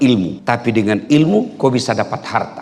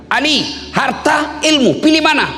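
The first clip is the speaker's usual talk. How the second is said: calling out a challenge. Acted. Questioner testing Ali on wealth or knowledge, high calling challenge. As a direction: put the choice to Ali, voice raised high.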